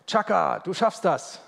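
high, loud mock pep-talk cheer; anfeuernd. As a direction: call it out like a motivational slogan. Spielt einen Motivationsspruch vor. (gespielt anfeuernder, hoher Zuruf)

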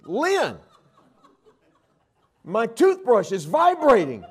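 high, loud startled shout to his wife; husband shouting to his wife about the toothbrush, loud and startled; shocked outcry. Retold or imitated. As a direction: yell it in disbelief at the buzzing brush.

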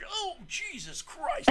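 high, drawn-out yelp; hammed-up high wail; mock shocked cry. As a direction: cry out slowly as if zapped. Acts out man shocked by taser.

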